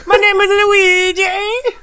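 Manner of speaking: high, slow, sobbing Italian voice; hammed-up Italian accent. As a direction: ham up a wailing Italian. Switching to Italian impression.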